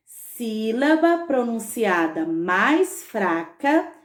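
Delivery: slow, measured dictation; word by word, unhurried. Shown as a demonstration. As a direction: dictate it slowly for someone writing. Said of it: "Você vai colocar assim".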